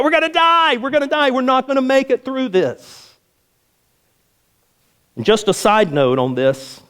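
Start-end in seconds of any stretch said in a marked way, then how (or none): 0.0-3.0 higher, panicky wail of doom; hammed-up panic, pitched high; mock panic. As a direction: play the frantic doomsayer. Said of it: "you're like".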